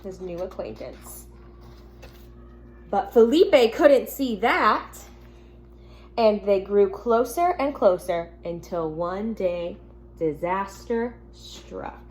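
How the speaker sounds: gentle storybook reading to the class; reading to children. Acted. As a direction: read it like a teacher at story time.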